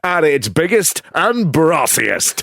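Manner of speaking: upbeat hammed-up radio trailer; mock Capital Radio announcer, half laughing; radio promo voice. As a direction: sell it like a cheesy radio link. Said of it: "I'm gonna do one of those Capital Radio links".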